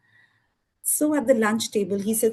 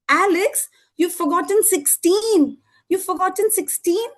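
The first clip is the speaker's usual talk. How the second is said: naughty teacher; 6 semitones higher than usual. Acted. Math teacher points out Alex's mistake, higher and teasing. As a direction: tease him like a cheeky teacher.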